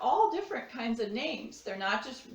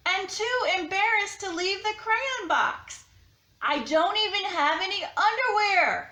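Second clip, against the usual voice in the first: higher, mock-embarrassed crayon; peach crayon letter voice; 7 semitones higher, 5 dB louder, slower than usual. To Duncan, an embarrassed crayon begs for clothes. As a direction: play up a flustered naked crayon.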